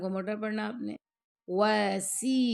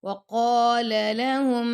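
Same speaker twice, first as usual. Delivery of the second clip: tajweed recitation; 3 semitones higher, 8 dB louder, much slower than usual. Shown as a demonstration. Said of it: "لام کو لمبا نہیں آپ نے کرنا".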